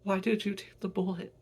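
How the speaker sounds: high, distressed questioning; anguished plea. Acted. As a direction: cry out in disbelief. Son grieving shot mother.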